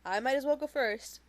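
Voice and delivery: sounding like everyone begged her; reluctant martyr. Acted. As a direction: sigh it out as if forced to share. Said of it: "like everyone else is begging her to talk".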